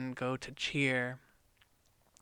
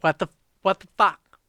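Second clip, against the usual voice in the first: high, louder furious yelling; angry yell; 4 semitones higher, 9 dB louder than usual. Retold or imitated. Eustace yelling at the dwarves, outraged shout. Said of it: "He starts yelling at the dwarves".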